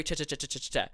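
"cha cha cha" as a run of CH syllables; repeated cha sound. Shown as a demonstration. Demonstrating the dialect's sound, "cha cha cha" in a string. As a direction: rattle off "cha cha cha", every one a crisp CH. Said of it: "speaks more with a cha".

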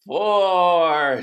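loud, drawn-out number; stretched-out count; long count. Shown as a demonstration. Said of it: "this is a long one".

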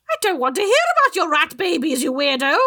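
high, fast witch voice; witchy voice. Acted. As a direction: snap at them like a witch.

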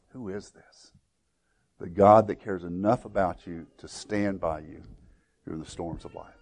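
very soft, low voice; hushed reverence. Real, not acted. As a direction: let the closing thought sink almost to a murmur. Hushed close of the sermon.

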